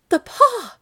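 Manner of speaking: high, very loud frantic shout; wild cry. A narrator's reading. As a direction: cry out wildly at the top of your voice. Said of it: "she cried wildly".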